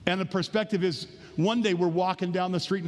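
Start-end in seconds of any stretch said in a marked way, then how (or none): none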